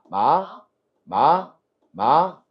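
drawn-out 마 sweeping upward; second tone. Shown as a demonstration. Teacher models second tone, 마 rising from mid pitch. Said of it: "미에서 시작해".

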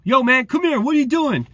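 loud, high confrontational call-out; aggressive guard. Retold or imitated. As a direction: imitate a guard hollering someone over. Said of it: "to be aggressive instantly".

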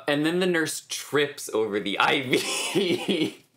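slow, laughing through it; chuckling at the memory. Real, not acted. Laughs recalling nurse tripping.

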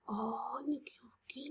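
hushed, whispery pretend "oops"; whispered oh-no for children; mock dismay. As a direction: play a soft gasp at your own mistake. Teacher stages a counting mistake.

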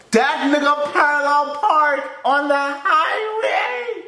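high, slow, drawn-out yell of disbelief; stunned shout. Real, not acted. Disbelief at the driving.

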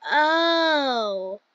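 long, drawn-out "oh" of understanding; retold realization. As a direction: let the penny drop on one stretched oh. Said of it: "I was like".

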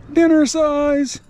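high, slow drawn-out Dinner size; amused mock emphasis. Real, not acted. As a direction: stretch it out, amused and teasing. Amused repeat after laughing.

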